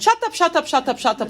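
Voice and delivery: put-on high-pitched Israeli-accented rapid repeat. Quoting the Israeli friend's allowed outburst, Israeli accent. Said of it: "four times in Israeli accent".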